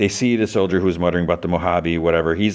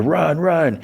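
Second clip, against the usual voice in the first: mock yell; 10 semitones higher than usual. Yelling for everyone to run.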